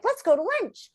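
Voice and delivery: high, bright invitation; imitating Johnny. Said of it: "he was like".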